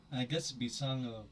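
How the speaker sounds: normal talking voice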